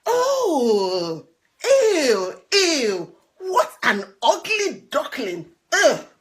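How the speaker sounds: loud sneering ew, ew and ugh; mock him with disgust; mean farm animals. Farmyard animals jeering at Tufty, loud and disgusted. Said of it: "cried the other animals".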